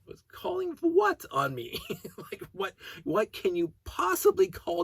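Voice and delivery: what on earth, stretched out; exasperated disbelief at the neighbor's threat; incredulous. Real, not acted.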